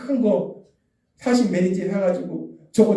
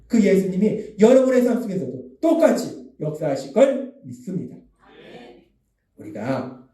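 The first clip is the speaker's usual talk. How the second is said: ordinary talk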